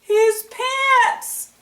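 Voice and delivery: a gleeful punchline; amused reveal. Real, not acted. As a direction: deliver the punchline with delight. Revealing the joke.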